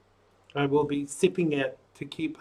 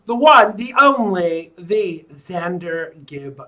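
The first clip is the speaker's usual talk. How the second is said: show announcer; 5 dB louder than usual. Acted. Introduces his own show.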